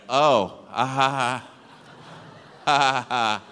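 imitated awkward, halting chuckle; stilted laughter with nothing to say; nervous laugh. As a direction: fake an uneasy "ha, ha, ha". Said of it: "He is so nervous".

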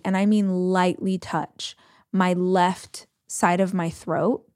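ordinary delivery

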